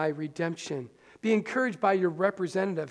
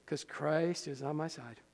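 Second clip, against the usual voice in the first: hushed, under the breath, much softer; whispered to yourself; 4 semitones lower, 9 dB quieter than usual. Shown as a demonstration. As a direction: murmur it privately so no one hears. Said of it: "you can just whisper it to yourself".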